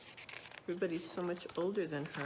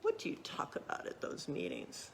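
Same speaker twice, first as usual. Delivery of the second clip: whispered question; 5 semitones lower, 7 dB quieter than usual. A narrator's reading. Interrogator questions a child.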